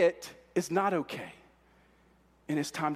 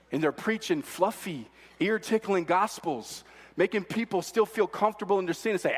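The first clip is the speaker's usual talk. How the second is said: usual speaking voice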